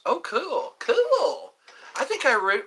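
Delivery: high, loud delighted cool; high excited cool. Real, not acted. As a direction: squeal with delight. Excited reply to viewer.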